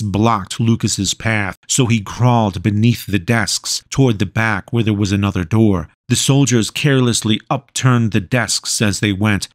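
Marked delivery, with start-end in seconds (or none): none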